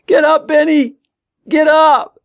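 high, loud, frantic command; terrified yelling. A narrator's reading. Tommy to Ben lying on the rails, terrified yelling. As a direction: scream at him to move.

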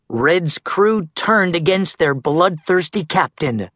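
usual speaking voice

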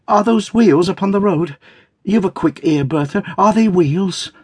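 high, eager questioning as Dot; Dot, excited. Acted. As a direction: ask in a high, urgent little wife's voice.

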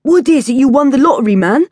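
high, loud persistent taunt; pushy street kid. A narrator's reading. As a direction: keep pressing him, grinning. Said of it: "Lucas persisted".

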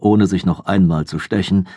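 ordinary delivery, nothing marked

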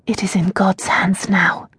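hushed, breathless whisper; barely voiced words of despair. Prisoner Tasia to her servant, whispered.

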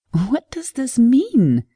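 high, loud, slow and shaken; frightened question after a nervous laugh. Acted. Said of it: "She nervously laughed".

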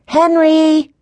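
high, loud, drawn-out call. A narrator's reading. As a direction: call for someone down the hall. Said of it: "called Jessie".

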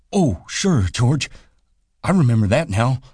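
a simple giant suddenly remembering, pleased; high, delighted simple man; delighted Lenny. A narrator's reading.

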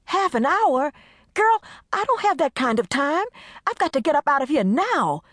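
high, loud impatient wail. Acted. Desiree wailing at Carmen that she can't wait. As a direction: wail for her to hurry. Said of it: "Desiree wailed impatiently".

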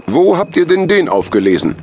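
high, loud astonished question; high-voiced, loud mayor; mayor character. A narrator's reading. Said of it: "fragte der Bürgermeister".

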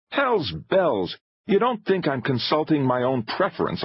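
high-pitched, exasperated protest; indignant retort, pitched well up. A narrator's reading. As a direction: burst out in irritation. Filmmaker exasperated in argument.

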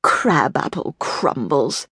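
low, slow scornful snort; snorting scornfully. Acted. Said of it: "King Steve snorted".

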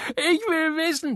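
put-on high little-boy voice, pestering; a small boy nagging his mother at the table; whiny child. Little boy pestering his mama at dinner, high child voice.

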